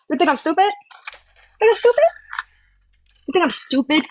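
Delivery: shrill high sneer, Think I'm stupid thrown way up; mock her as if she's the fool; angry taunt. Real, not acted. To the rival threatening her kids, scornful and angry.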